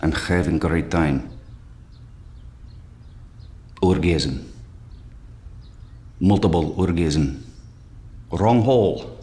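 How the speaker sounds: put-on deadpan Russian accent, low and straight across; Russian monotone. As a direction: imitate a Russian woman with no inflection, long pauses. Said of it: "total monotone".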